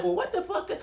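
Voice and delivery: mock bewildered aside; retold sudden confusion. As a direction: catch yourself mid-rant, baffled. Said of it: "I was like".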